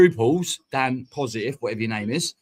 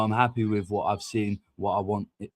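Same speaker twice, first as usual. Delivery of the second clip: usual speaking voice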